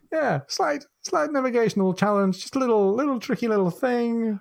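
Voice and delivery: high, light, playful downplaying; mock British understatement. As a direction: make a big problem sound like a trifle. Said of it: "they are masters of British understatement".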